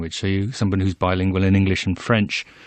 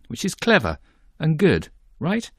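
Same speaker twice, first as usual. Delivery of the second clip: rising, higher, leading question with a wink; teasing doubt; 8 semitones higher than usual. Real, not acted. Presenter hinting at doubt before introducing a sceptic, with a rising teasing lilt. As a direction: set up the doubt, pitch climbing on right.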